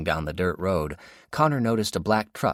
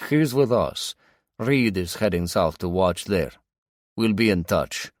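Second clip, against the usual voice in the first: put-on Russian accent; 4 dB louder than usual. A narrator's reading. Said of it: "heavy with a Russian accent".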